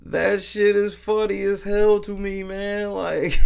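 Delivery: high, breaking into laughter; can't hold the laugh in; cracking up at the joke; laughing hard. Real, not acted.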